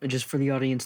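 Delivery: ordinary delivery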